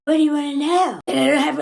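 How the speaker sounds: loud, rough mock outburst; imitated yelling. Man cornered by her questions, mock yelling. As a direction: bellow it as his imitation, words tumbling out. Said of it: "he's yelling all of this".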